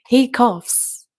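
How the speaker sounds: loud and high, the line punched out; loud take. Shown as a demonstration. Speaker demos the line three ways, first loud. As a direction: say it out loud and high, a full-voiced demo line. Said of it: "repeats line in different ways".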